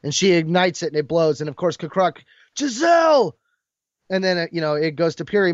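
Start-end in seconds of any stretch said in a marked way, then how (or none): 2.5-3.3 high, loud cry of her name; anguished cry. Retold or imitated. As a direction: cry out in loss. Crying out as she blows up.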